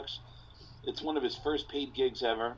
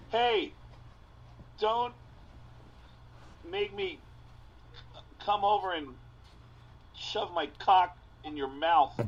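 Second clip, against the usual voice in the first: halting, higher, every word separated by pauses; awkward delivery; 6 semitones higher than usual. Retold or imitated. New comic threatening a heckler, in a stiff halting higher voice. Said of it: "in the most awkward delivery I've ever heard".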